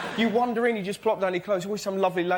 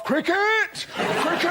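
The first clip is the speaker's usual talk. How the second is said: high, excited exclamation; Telegraph cry; 7 semitones higher, slower than usual. Acted. Telegraph telling them its concern, high excited cry. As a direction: cry it out as a newspaper's battle call. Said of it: "Tell 'em, Telegraph".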